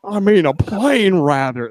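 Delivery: high, drawn-out bad Texas accent; put-on Texan voice, badly done; terrible Texas accent. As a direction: ham up a clumsy Texas drawl, pitched high. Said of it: "That was a terrible Texas accent".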